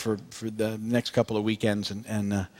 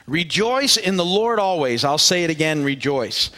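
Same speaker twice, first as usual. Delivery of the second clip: scripture reading; 4 semitones higher, 4 dB louder than usual. Acted. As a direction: read it out to the whole room.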